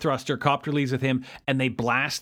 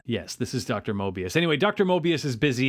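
normal talking voice

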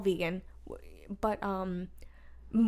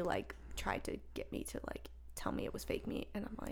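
whispered, much softer; hushed whisper; 3 semitones lower, 12 dB quieter than usual. Real, not acted. Confiding about her dad in a hushed whisper.

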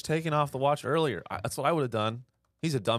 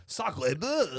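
mock French exclamation; 2 semitones higher than usual. Mocking the French.

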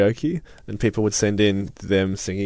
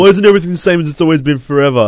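fast, loud, high whiny complaint; whiny mocking voice; 6 semitones higher, 8 dB louder, faster than usual. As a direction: whine it like a spoiled listener. Mocking entitled radio listeners.

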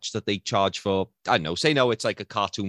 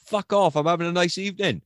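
mock angry outburst; 8 semitones higher than usual. Telling pestering fan where to go.

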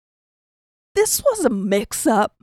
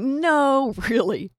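awkward insistence; 7 semitones higher, much slower than usual. Retold or imitated. Fending off the insistent girl.

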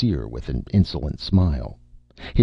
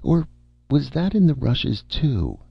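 high, uncertain star voice; higher-pitched, vacant recollection; vague, dim film star; 11 semitones higher than usual. Acted. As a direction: wonder aloud in a higher, muddled voice.

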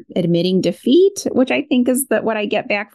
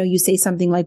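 ordinary talk

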